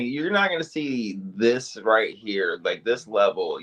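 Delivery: ordinary talk, nothing marked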